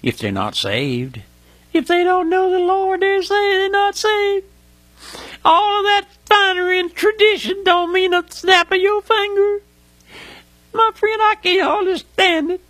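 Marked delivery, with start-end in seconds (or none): 1.7-12.6 high, strained cry from the pulpit; overcome with emotion. Real, not acted. Preacher grieving over folks not saved, overcome with emotion. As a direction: let real anguish push the voice way up. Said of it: "I get emotional sometimes thinking about".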